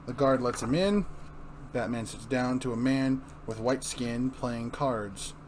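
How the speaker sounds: ordinary talk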